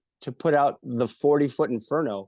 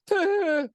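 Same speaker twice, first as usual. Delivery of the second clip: high burst of laughter; 4 dB louder than usual. Retold or imitated. Laughing at the fighter.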